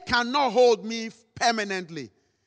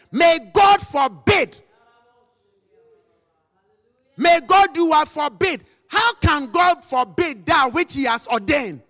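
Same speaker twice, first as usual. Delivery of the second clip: loud, high-pitched preaching at full force; fiery preaching; 5 semitones higher, 5 dB louder than usual. Acted. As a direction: thunder it from the pulpit.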